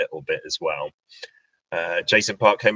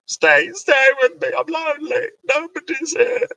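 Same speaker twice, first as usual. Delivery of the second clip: mock-lonely pleading; 4 dB louder than usual. Host begging YouTube viewers not to leave, mock pleading. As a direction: playfully beg them to stay in a tiny high voice.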